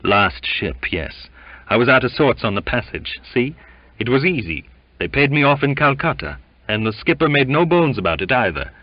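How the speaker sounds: lightly voiced sick sailor boasting; letting go. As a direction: spill it out gleefully. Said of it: "he let himself go".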